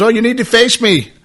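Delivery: imitating a deaf woman's loud, high voice. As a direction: call it out high and over-loud. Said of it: "but very loud".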